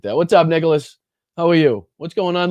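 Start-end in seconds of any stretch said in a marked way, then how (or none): none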